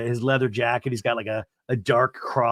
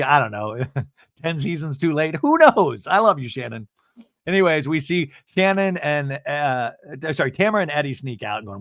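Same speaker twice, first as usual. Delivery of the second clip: ordinary talk, nothing marked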